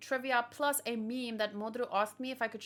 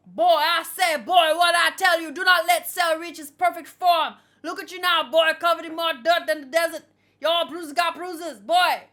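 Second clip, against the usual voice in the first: loud, high-pitched hammed-up Texan holler; exaggerated Texan character voice at full volume; rough Texas accent; 6 semitones higher, 13 dB louder than usual. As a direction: belt out a mock Texas accent, booming on "boy". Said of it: "how to do this guy's accent".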